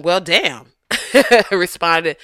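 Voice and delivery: usual speaking voice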